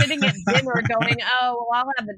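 mock whining. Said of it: "And I'm like".

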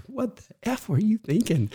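high, shrill-pitched mock outburst; imitating an angry sister. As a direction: snap at him like a furious sister in the back seat. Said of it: "she started swinging at me".

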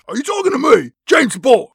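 high, loud mock calling-out; excited shouting. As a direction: holler it up to the villain, hammed-up. Acted Bond calling up to Blofeld.

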